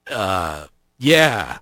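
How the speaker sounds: low, soft, groggy reply; hesitant low cat voice; dazed bobcat. A narrator's reading. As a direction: mumble it deep and bewildered. Dazed Bobcat answers the mayor.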